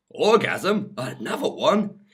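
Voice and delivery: high, loud, incredulous question; shocked rising squeak of a question; astonished. A narrator's reading. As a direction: blurt it out in startled disbelief. Heroine startled by the offer.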